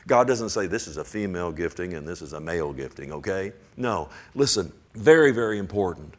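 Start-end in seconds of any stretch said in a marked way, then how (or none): 0.7-3.1 a lowered, mocking take on a false teaching; deep mock voice; mock pompous voice. Preacher mocking a claim God never made, in a deep mock voice.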